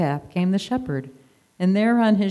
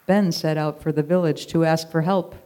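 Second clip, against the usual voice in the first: usual speaking voice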